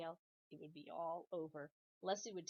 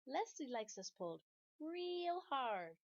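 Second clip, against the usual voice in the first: high, slow, sly pigtail; 6 semitones higher, slower than usual. Acted. Pigtail fibbing to Paul, high, slow and sly. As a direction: fib sweetly and slowly.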